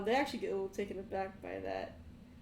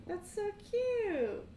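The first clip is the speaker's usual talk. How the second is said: mocking coo; 13 semitones higher, 5 dB louder, much slower than usual. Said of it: "They start laughing".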